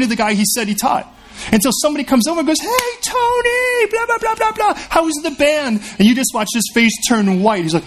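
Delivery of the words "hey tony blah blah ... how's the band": high, drawn-out call across the room; friend calling out. Retold or imitated. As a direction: stretch "Hey, Tony" up high and slow, then rattle the blah-blahs. Friend calls out to Tony.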